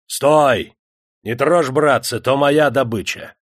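loud, firm order to the crowd; commanding shout. A narrator's reading. The leader stops the crowd, commanding shout. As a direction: halt them with authority.

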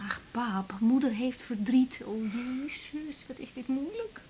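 zacht, fluisterend en gebroken; verdrietig. Acted. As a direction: breek bijna, heel zacht. Dochter wanhopig tussen ouders. (dochter wanhopig zacht tegen vader)

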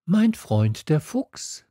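put-on high, slow young voice; Little Prince. A narrator's reading. Prince tells the pilot about his friend, high and slow. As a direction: begin it slowly and high. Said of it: "sagte er zu mir".

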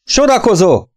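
high yelled order to line up; shouted command. A narrator's reading. Instructor yells at the boys to line up. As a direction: yell it across the room. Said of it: "ordította az oktató".

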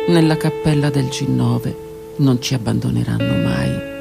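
ordinary delivery

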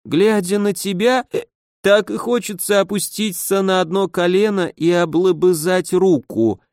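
put-on drunk slurring. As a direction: slur it like a sentimental drunk. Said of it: "заплетающимся языком пробормотал".

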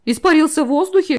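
high squealing fright; frightened shriek. A narrator's reading. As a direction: squeal it, terrified. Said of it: "взвизгнул ещё больше напуганный король".

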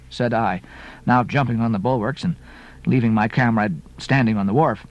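ordinary delivery

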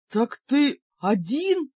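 demon disbelief. Acted. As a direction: drag out the doubt.

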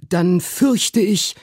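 high, slow uncle; Uncle Toby's voice. A narrator's reading. Uncle answers his brother about the boots, high and slow. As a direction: draw it out slowly in a high voice. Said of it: "sprach mein Onkel Toby".

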